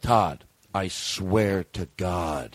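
drawn-out serious swear; slow solemn oath. Retold or imitated. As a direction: sell it slowly and earnestly. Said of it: "But still to sell it".

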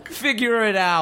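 hammy New York Italian; New York Italian accent. Pretend. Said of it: "The logical New York Italian guy".

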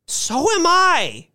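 put-on very high, forceful old-lady voice; indignant retort. A narrator's reading. Old woman cutting off Mister Thurkle's excuse, retorting indignantly.

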